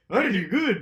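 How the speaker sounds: comic muddle of Indian and Scottish accents; badly blended Indian-Scottish accent. Shown as a demonstration. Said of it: "my Indian and Scottish accents blend together".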